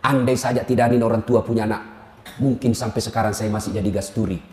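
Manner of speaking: low, soft, pious thanks; mock grateful prayer. Wife thanks God for her in-laws, softly. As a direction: pray quietly in gratitude.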